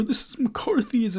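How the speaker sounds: mock whiny critic. Mocking critics who cry McCarthyism, a high whine. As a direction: parody an offended protester.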